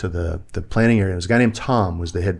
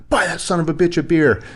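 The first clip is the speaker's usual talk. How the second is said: higher, louder phone-call exclamation; punchy mock order, pitched up; emphatic retold order; 9 semitones higher, 4 dB louder than usual. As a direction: imitate an insistent, excited command. Retold enthusiastic order over the phone.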